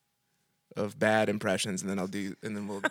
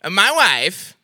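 put-on high, loud Kazakh-accented Borat line; 7 semitones higher, 8 dB louder, much slower than usual. Prank caller does Borat for a bookstore clerk, in a bad Borat accent. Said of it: "here's my impression of Borat".

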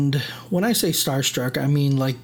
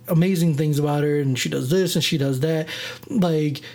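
normal talking voice

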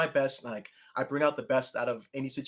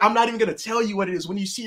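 usual speaking voice

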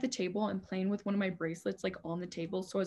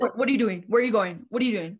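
louder, lightly imitated suspicious guard; mock interrogating guard; 6 dB louder, faster than usual. Lunchroom guards questioning kids leaving, mock interrogation. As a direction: fire off challenges like a nosy hall monitor.

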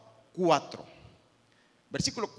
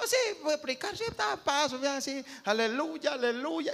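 overconfident; 7 semitones higher than usual. Retold or imitated. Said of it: "a veces como confiado".

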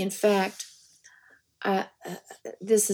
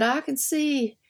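higher put-on voice of a rotund sheriff; higher mock sheriff voice; 6 semitones higher, slower than usual. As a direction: play the sweaty small-town sheriff sizing her up. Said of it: "he said".

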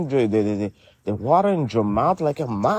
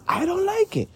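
high, squeaky mock offense; high squeaky protest. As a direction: squeal it in fake outrage. Mock outrage at the accent.

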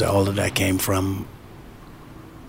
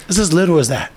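higher and louder insistence; emphatic; 9 semitones higher, 7 dB louder than usual. Real, not acted. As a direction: drive home how small it is. Emphatic punchline.

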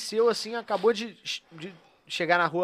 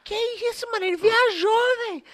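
squeaky mock voice; 13 semitones higher, 4 dB louder than usual. Imitating friend.

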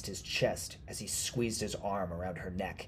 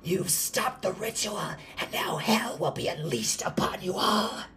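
high-pitched, loud screech of doom; screaming witch; 12 semitones higher, 5 dB louder than usual. A narrator's reading. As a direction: shriek it like a cursing witch. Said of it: "she screamed".

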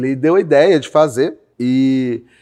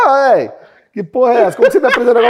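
higher and louder, half laughing in disbelief; mock incredulous investors; 11 semitones higher, 6 dB louder, much faster than usual. Investors scoffing at the pitch, higher, louder, laughing disbelief. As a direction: play the baffled investor scoffing. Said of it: "os caras".

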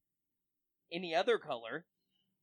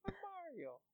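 high put-on voice; 18 dB quieter, much slower than usual. Joking voice for a character.

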